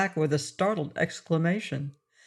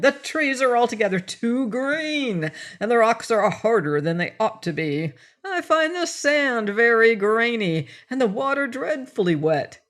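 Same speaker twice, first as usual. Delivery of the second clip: a small fussy man grumbling; fussy little man; 5 semitones higher than usual. Acted. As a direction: complain like a petty little grump.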